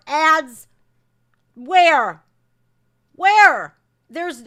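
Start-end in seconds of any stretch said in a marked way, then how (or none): 1.5-2.2 sharp, much louder demand; angry shout. Real, not acted. Creator shouting at YouTube over demonetized video, angry. 3.1-3.8 higher and even louder, pushing harder; angrier shout. Real, not acted. Creator repeats demand at YouTube, angrier and higher. Angry rant at YouTube ad ruling.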